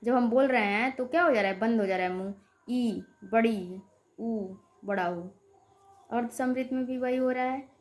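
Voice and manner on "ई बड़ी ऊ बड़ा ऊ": slow vowel demo. Shown as a demonstration. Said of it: "मुख द्वार लगभग पूरा जब बंद रहता है".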